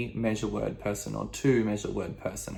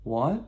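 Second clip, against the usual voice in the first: "What" lifted like a query; questioning rise; 3 semitones higher than usual. Shown as a demonstration. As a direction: let "What" climb in pitch.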